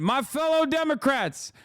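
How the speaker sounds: hammed-up elderly president voice, high and strained; Joe Biden impression. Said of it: "Joe Biden said".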